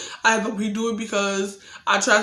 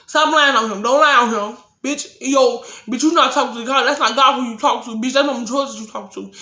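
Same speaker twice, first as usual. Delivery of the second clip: retold furious threat; 2 semitones higher, 3 dB louder than usual. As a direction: threaten him back at full heat. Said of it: "And I said".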